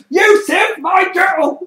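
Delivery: very high, loud laughing protest; indignant outburst. Retold or imitated. Assassin robbed of his kill, high loud laughing protest. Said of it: "the assassin's like".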